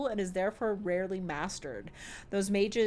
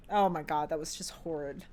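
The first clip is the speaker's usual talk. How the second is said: ordinary delivery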